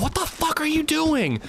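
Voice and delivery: high-pitched yell without full volume; strained, high, half-volume holler; mock yell. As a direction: imitate a buddy yelling in outrage. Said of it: "my buddy yells at her".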